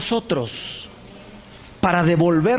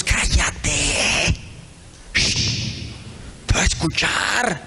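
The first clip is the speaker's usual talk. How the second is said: hushed whisper; 3 semitones lower, much slower than usual. Retold or imitated. Brothers hush David near Goliath.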